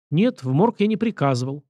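usual speaking voice

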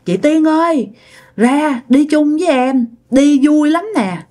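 a spirit luring her away, sweetly; ghostly coaxing. Retold or imitated. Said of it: "nghe giọng Tư kêu rằng".

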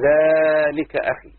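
long drawn-out first syllable of ذلك; stretched for distance. Shown as a demonstration. Teacher showing pupils a farther brother, ذلك drawn out. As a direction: stretch ذلك slowly to point far away. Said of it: "إذا كان أبعد في المسافة".

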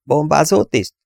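BOMBÁZÓ TISZT as a high question, rising then falling; inquiring. A narrator's reading. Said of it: "érdeklődött Dennis".

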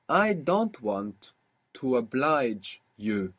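I don't want to oblige you, spoken very slowly; slow prompt. Shown as a demonstration. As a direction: draw out the prompt for the learner. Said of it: "How would you say then".

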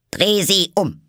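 loud, high, irritated order; angry command. A narrator's reading. Queen ordering the Knave about, angry shout. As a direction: bark it out crossly. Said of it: "wandte sich ärgerlich von ihm ab".

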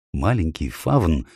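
ordinary delivery, nothing marked

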